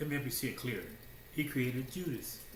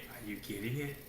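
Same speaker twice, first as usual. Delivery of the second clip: ordinary talk, nothing marked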